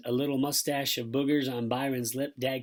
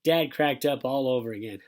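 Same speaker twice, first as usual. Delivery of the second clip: usual speaking voice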